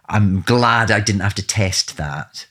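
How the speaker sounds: normal talking voice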